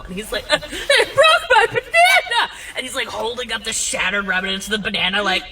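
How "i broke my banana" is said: very high, loud drawn-out wail; hammed-up sobbing over a disaster; mock bawling. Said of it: "he just starts bawling".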